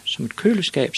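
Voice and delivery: put-on high-pitched, matter-of-fact kid voice; high child reply, falling at the end; little boy Ivan. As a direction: answer up high as a small boy. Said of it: "sagde Ivan".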